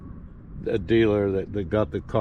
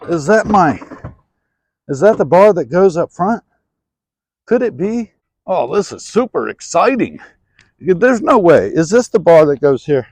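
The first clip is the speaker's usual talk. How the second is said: excited; 7 semitones higher, 10 dB louder than usual. Real, not acted. Excited finding the bar.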